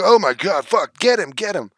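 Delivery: high, loud panicked "get him"; mock frenzied yell. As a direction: ham up a frantic mob crying "get him". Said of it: "They're like".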